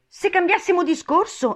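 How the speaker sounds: very high, loud interjection; interrupting March Hare. A narrator's reading. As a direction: cut in as a bored hare. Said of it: "interruppe la lepre marzolina con uno sbadiglio". (interruzione acuta della lepre annoiata)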